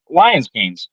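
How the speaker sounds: ordinary talk, nothing marked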